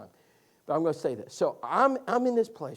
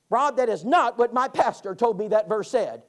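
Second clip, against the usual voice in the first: put-on loud, indignant old lady; indignant surprise; 3 semitones higher, 9 dB louder than usual. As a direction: mimic a shocked elderly woman protesting. Bettina shocked about pastor.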